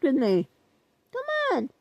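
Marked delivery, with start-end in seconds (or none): 1.1-1.7 pleading whine. Acted. Pleading with viewers to interact.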